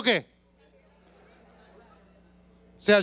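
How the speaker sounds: usual speaking voice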